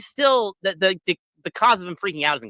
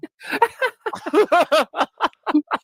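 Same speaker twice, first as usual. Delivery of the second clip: high laugh; 4 dB louder than usual. Real, not acted. Group laughing at the joke.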